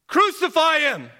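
very high, loud yell; out-of-control crowd cry; frenzied mob shout. Acted. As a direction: scream for blood with the crowd. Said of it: "they shouted".

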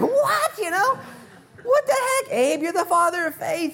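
very high, loud squeal of disbelief through laughter; outraged Sarah. Retold or imitated. Preacher voicing Sarah aghast at Abraham giving her away, shrill laughing. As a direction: shriek it like a wife betrayed. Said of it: "And Sarah's like".

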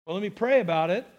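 fast, offhand "Well, let me pray about it."; rushed imitated answer; quick reply. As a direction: toss it off quickly, lightly imitating. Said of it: "he said to me quickly".

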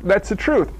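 normal talking voice

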